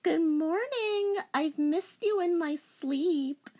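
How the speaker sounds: put-on high, slow sweet girl voice; lovestruck anime girl. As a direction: coo it sleepily to your lover. Voicing girl character.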